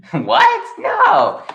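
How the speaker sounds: very high squeaky protest; high put-on character voice. Storyteller in Josh's voice answering the listener, high squeaky disbelief. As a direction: squeak it far above your own voice.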